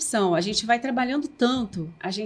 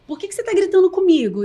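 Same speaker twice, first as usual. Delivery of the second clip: daughter's complaint; 5 semitones higher, 5 dB louder than usual. Retold or imitated. Daughter complaining about mother yelling.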